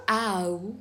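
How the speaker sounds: Au as one drawn-out glide from a to u; isolated diphthong. Shown as a demonstration. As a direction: glide Au out on its own. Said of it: "I am going to pronounce".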